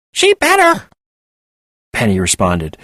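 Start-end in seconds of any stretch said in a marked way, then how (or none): 0.1-0.9 very high, loud squeaky screech; helium screech. Acted. Penny insisting Desiree answer, high squeaky screech. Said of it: "another screech of helium-powered vocal cords".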